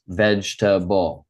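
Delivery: Vegetable said slowly as veg-ta-ble, three clear syllables with a firm veg. Shown as a demonstration. Teacher modelling Vegetable in three syllables for the student. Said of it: "we're gonna say it in three syllables".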